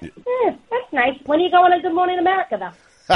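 high, unimpressed mother's voice, quick at the end; imitating his mom. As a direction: play the mom who shrugs off his news, lightly mocking. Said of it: "she's like".

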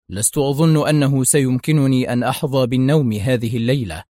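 usual speaking voice

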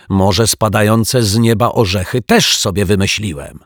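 irritated sarcasm. Acted. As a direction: throw the sarcasm back. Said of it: "powracającą irytację". (ironicznie, z irytacją)

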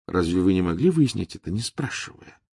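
usual speaking voice

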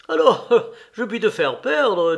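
amused, laughing boast. Acted. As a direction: tease a friend through a laugh. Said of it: "dit Vronsky en riant".

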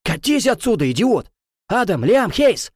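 high furious yelling; shouting. Acted. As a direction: yell and call for help. Kicking out, calling friends.

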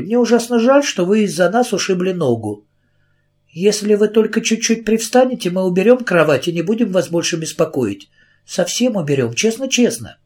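eager pleading. A narrator's reading. Carrie pleading with policeman.

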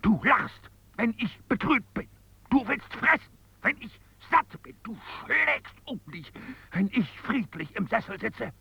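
high, strained outburst of insults; angry scolding. Acted. Man scolding the scoundrel inside him, angry.

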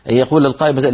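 ordinary delivery

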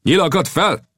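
high, sharp command; barked order. Acted. Chief ordering his warriors to aim, sharp command. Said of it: "A törzsfőnök is kiadta a parancsot".

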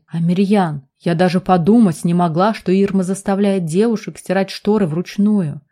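plain reading voice

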